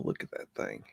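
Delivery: hushed whisper of awe. Real, not acted. Marvelling at the structure, whispered. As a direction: breathe it out, voiceless.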